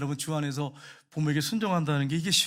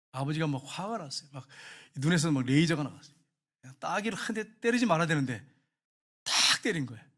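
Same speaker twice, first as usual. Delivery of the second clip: ordinary delivery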